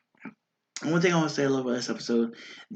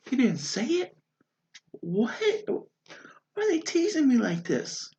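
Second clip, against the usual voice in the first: mock disbelief; 11 semitones higher than usual. Mock outrage at the tease.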